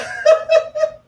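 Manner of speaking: squealing laugh; high giddy laugh. Real, not acted. Giddy laugh after seeing him.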